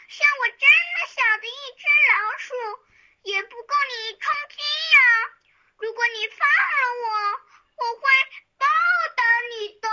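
high, pleading mouse voice with a hint of tears; plead, a little tearful, for your life. A narrator's reading. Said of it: "老鼠继续哭着说".

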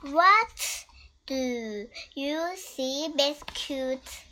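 gentle, sing-song picture book read; reading to a toddler. Acted. Parent reads Biscuit book to child.

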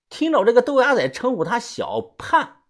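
plain reading voice

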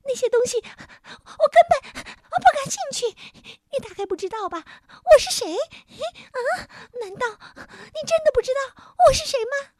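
very high, squeaky indignant yelling; rude shouting little creature. A narrator's reading. Little man demands why the king came here, rude piercing shouts. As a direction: rant shrilly like an offended imp, with loud bursts. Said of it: "小人儿粗野地叫喊着".

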